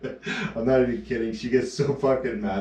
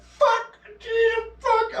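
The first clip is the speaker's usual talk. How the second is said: much higher, strangled yelps of pain; mock pained yelp; much slower than usual. Emma stubbing her toe, imitated pained squeal. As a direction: squeal curses like you just stubbed your toe.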